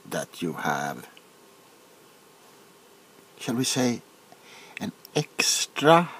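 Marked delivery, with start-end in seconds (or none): none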